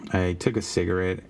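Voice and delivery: ordinary delivery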